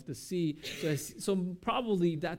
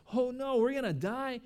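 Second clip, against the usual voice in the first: mock-panicked cry, higher pitched; 8 semitones higher than usual. Fishermen panicking in the crazy storm, mock panic. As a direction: act out fishermen panicking, voice jumping up. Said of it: "for them to panic and says".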